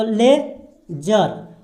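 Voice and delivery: ले जर, split into two slow syllables, ज sound clear; teacher slowly sounds out ले जर for students, spelling the word aloud. Shown as a demonstration. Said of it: "ज का उच्चारण आ रहा है इसमें".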